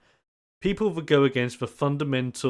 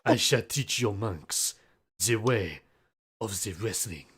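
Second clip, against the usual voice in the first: put-on Spanish wrestler accent; 5 semitones lower, 5 dB quieter than usual. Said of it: "he sounds like a Slav Spanish man".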